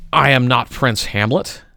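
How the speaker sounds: ordinary delivery, nothing marked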